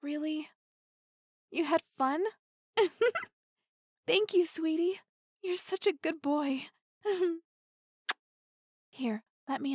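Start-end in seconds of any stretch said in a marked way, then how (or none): 2.8-3.2 high loud bright giggle; delighted high laugh; happy giggle. Acted. Happy laugh after his answer. 4.1-6.6 affectionate thanks. Acted. Affectionate thanks after scene.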